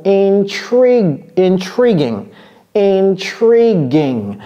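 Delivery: "intriguing" slowly, with plain "ging" ending; careful pronunciation. Shown as a demonstration. Teacher answers how this word is pronounced, careful model. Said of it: "comment prononce-t-on ce mot?".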